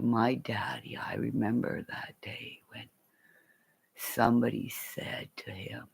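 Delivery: soft hushed whisper; whispered remembrance. Real, not acted. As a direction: confide the memory under your breath. Whispering memory of father.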